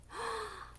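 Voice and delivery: sharp high gasp. Acted. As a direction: gasp in mock shock for the kids. Storyteller reacts to Goldilocks eating.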